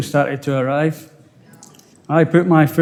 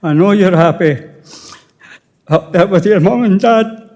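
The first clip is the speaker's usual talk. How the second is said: tearful farewell; 5 semitones higher than usual. Real, not acted. Farewell to late wife.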